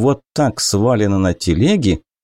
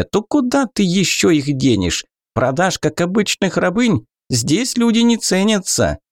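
high, coaxing goblin; 5 semitones higher than usual. Acted. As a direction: wheedle the orc in a high goblin voice. Goblin persuading the orc.

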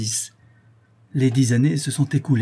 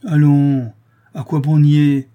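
imperturbable; 4 semitones higher, 8 dB louder, slower than usual. A narrator's reading. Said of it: "reprit Maurice imperturbable".

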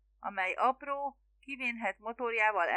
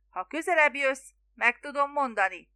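raised, loud shout; hollering; 5 semitones higher, 4 dB louder than usual. A narrator's reading. Spiro coaxing the boatman closer, hollering. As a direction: holler it to someone out of earshot. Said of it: "üvöltötte Spiro".

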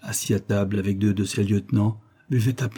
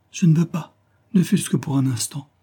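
higher-pitched, mildly alarmed plea; frightened; 6 semitones higher than usual. A narrator's reading. Young woman begs not to leave her lover, frightened. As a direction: plead with a touch of fear. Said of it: "dit la jeune fille effrayée".

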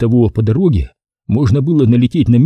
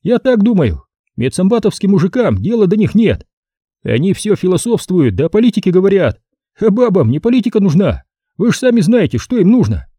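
higher, merry captain voice; jolly ladies' man, higher; cheerful Savelyev; 8 semitones higher than usual. A narrator's reading. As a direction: say it gleefully, pitched up. Savelyev lectures about women.

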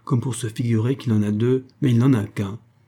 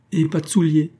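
higher, mildly plaintive lament; a touch of shamed weeping, read rather than sobbed; tearful shame; 6 semitones higher, 3 dB louder than usual. A narrator's reading. Tony lamenting his missing shoe, mildly tearful. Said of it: "en pleurant de honte".

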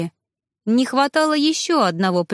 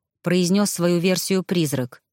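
plain reading voice

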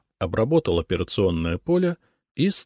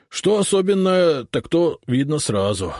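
higher, louder folksy cossack voice; rustic urjadnik; 9 semitones higher, 4 dB louder than usual. A narrator's reading. Urjadnik to Lukich, grudgingly impressed. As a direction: concede it with a grudging sigh.

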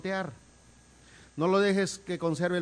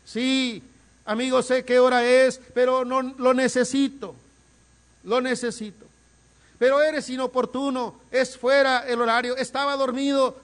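high, loud, slow pleading; insistent pleading preaching; 9 semitones higher, 8 dB louder, slower than usual. Acted. Man at his friend's door at midnight begging for bread, loud insistent pleading. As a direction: beg without letting go.

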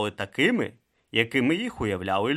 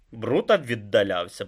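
ordinary delivery

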